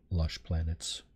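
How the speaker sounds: usual speaking voice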